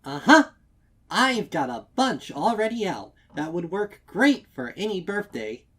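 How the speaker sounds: high, loud, overly bright sales voice; sugary-sweet pony at the counter, trying hard; chipper shopkeeper. A narrator's reading. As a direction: sell it with a forced grin. Chipper shopkeeper answering customer.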